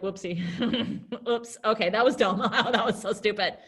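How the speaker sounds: giggling through the words at your own blunder; acted sheepish laughing. Teacher playing a babysitter who slipped up, laughing. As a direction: laugh it off, mock embarrassed.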